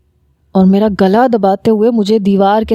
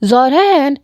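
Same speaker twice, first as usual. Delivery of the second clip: higher call from outside; 5 semitones higher, much slower than usual. A narrator's reading. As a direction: call his name through the door. Uncle calls again.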